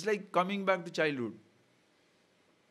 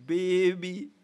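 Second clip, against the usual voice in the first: normal talking voice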